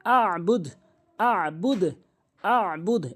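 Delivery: "আবুদ" with pressed throat ayn; correct ayn. Shown as a demonstration. Teacher drilling learners, "আবুদ" with throat ayn. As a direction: squeeze and hold mid-throat in "আবুদ". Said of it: "এভাবে".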